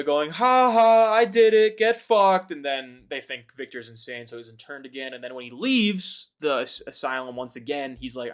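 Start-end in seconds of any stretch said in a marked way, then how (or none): 0.3-2.5 taunting. Acted. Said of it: "the monster is taunting goddamn Victor".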